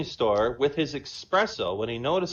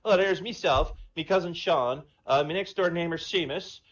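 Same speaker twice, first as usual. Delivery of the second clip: higher, louder Irish brogue; Irish accent; 5 semitones higher, 3 dB louder than usual. Acted. Paddy the Irishman phoning the president, Irish accent. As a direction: play a cocky Irish pub man. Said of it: "Irish and Jamaican".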